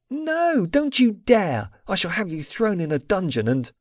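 high, outraged protest; haughty outrage, cut off mid-threat; indignant threat. A narrator's reading. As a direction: protest in a high voice, starting at the top.

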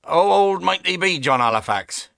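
put-on cross-examine the boy like a strict master; questioning Quaker father. A narrator's reading. Father questions the boy, lightly voiced stern father. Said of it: "this catechism".

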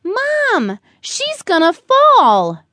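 put-on high, urgent child yell; little brother screaming. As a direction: shout a warning to Mom. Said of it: "and screamed".

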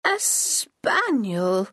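put-on slow, drawn-out old woman's crow. A narrator's reading. Granny crowing over the spaniel, slow and delighted. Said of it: "she crowed".

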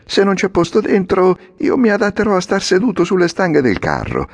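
put-on high, bright boy's voice, carefree; a sassy boy taking whatever seat he can; Lucignolo, cheeky boy. Lucignolo speaks to the coachman. (ragazzo sfacciato, voce alta e spavalda)

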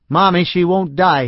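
put-on boy yelling to his mother; child's yell. A narrator's reading. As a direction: yell it like a frustrated kid. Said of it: "he yelled".

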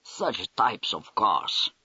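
put-on amazed, slow Russian-accented wonder. The Russian major marvels at the car park, slow, in a Russian accent. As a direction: draw it out slowly in a thick Russian accent. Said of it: "he exclaimed".

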